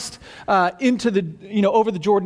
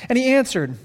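ordinary talk, nothing marked

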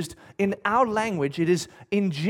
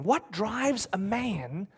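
much softer and very slow, rising "What drives a man"; hushed repeat; 8 dB quieter, much slower than usual. Shown as a demonstration. Pastor repeating the question to draw listeners in, hushed and slow. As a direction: repeat it near a hush, drawn out. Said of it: "Now, what drives a man?".

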